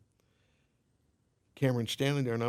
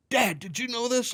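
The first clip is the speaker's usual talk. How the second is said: put-on stunned kid; 12 semitones higher, 5 dB louder than usual. Son stunned onstage surprise.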